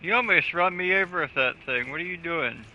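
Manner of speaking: ordinary talk, nothing marked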